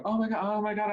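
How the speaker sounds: high panicked mock exclaiming. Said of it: "And I go".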